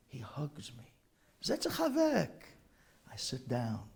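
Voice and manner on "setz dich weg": high, gentle offer of a seat; kindly elder invitation. Retold or imitated. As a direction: tell him to sit like a doting rebbe. Rebbe invites him to sit.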